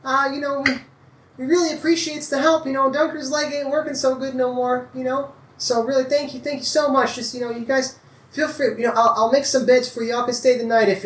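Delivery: a high, doting country mother; farm wife. Acted. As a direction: thank them warmly, fussing over guests. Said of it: "she kinda says, like".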